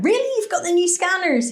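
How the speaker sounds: high-pitched, eager disbelief; delighted surprise. Retold or imitated. Asking airport staff about new scanners, delighted. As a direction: ask it like wonderful news.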